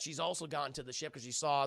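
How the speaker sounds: ordinary talk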